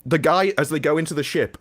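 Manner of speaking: usual speaking voice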